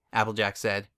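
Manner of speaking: ordinary delivery, nothing marked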